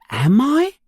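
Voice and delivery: high, amazed question; can't quite believe it; amazement. A narrator's reading. Boy just told he is in history books, amazed. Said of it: "asks Harry in amazement".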